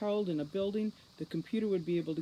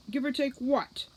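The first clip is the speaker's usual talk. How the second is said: incredulous demand; 9 semitones higher, 5 dB louder than usual. Acted. Root demands Foley explain.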